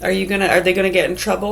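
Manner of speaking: ordinary delivery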